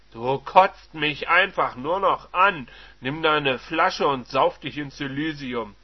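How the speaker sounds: loud, slow contempt; disgusted husband. Acted. Husband telling his wife off, loud and slow. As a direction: spit out disgust.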